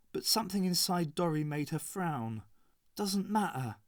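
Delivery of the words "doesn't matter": ordinary delivery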